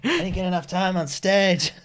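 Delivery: high, loud whining; whiny comedian voice. Retold or imitated. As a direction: whine like a needy comic.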